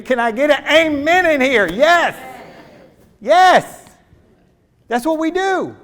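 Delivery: high, loud call for an amen; preaching shout. Real, not acted. Preacher rousing the congregation for an amen, full shout. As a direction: whip the congregation up.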